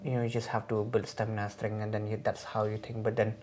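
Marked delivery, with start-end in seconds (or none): none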